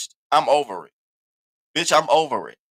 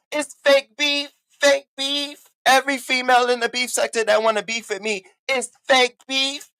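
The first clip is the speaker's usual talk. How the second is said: taunting refrain; 7 semitones higher than usual. Real, not acted.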